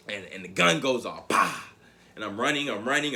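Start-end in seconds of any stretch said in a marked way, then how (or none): 1.3-1.6 gun bang. Retold or imitated. Imitating the starting gun.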